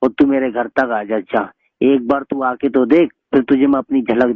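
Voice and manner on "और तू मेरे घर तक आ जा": ordinary talk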